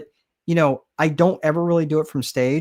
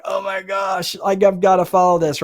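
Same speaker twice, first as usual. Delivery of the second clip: dismayed writer; 5 semitones higher than usual. Retold or imitated.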